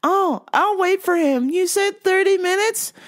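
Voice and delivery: high, halting confused customer. Retold or imitated. Customer asking the clerk for the vitamin man, high and confused.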